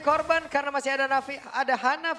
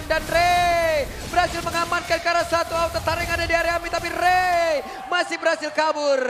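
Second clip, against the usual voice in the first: esports caster; 2 semitones higher, 4 dB louder than usual. Acted. Play-by-play chase call.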